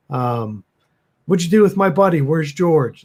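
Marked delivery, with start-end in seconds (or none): 1.3-3.0 raised, moderately loud demand; mock screaming. Arthur demanding his buddy back from the creature, mildly screaming. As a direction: half-shout an accusation, pitched up. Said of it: "they're just screaming at it".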